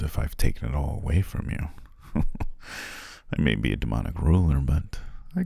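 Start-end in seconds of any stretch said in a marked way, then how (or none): 2.3-3.1 quiet, breathy chuckle; kingly chuckle. Acted. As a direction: chuckle softly at your own joke. Jokes about taxes as king.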